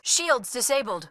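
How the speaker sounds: ordinary talk, nothing marked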